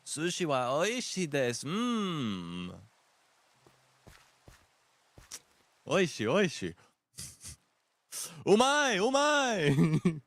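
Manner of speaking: hammed-up food praise. Gamer hams up sushi praise to friends, loud mock delight. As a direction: gush over sushi in put-on Japanese.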